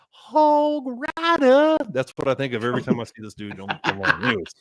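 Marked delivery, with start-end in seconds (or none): 0.1-1.8 high, long drawn-out battle cry; Mister T. Retold or imitated. Game character on the hog, hollering impression. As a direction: holler it slowly, stretching each word up high. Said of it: "they had Mister T on the hog, and he says".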